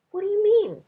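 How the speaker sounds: Minley can't believe what she hears; puzzled question. A narrator's reading.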